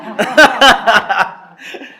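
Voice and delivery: loud, high, hearty laugh. Real, not acted. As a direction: laugh out loud at your own remark. Jokes about having a good audience. (carcajada sonora)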